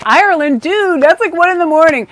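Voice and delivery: high, incredulous exclaim; amazed it's so late there; surprised. Retold or imitated. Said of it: "I was like".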